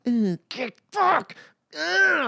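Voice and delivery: high yelped curse in pain; mock pained cry, high. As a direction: yelp it like you just hit your knee. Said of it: "I'm like".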